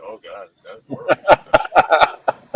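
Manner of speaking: slow, loud groan of disgust at the bad British accent; exasperated groan. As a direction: drag it out like the acting physically hurt.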